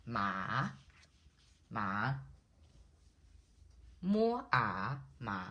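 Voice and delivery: "ma" dipping low then rising; falling-rising third tone. Shown as a demonstration. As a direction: say "ma" with a slow scoop, split once into "mo, a". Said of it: "is the falling rising tone".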